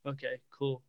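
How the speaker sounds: low, quiet, unbothered reply; soft, offhand mumble; blasé. Retold or imitated. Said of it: "he's so blasé about everything".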